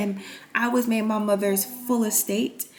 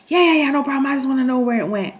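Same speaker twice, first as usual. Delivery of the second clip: mock stepdad; 6 semitones higher, 5 dB louder, faster than usual. Stepdad voiced, eager reply.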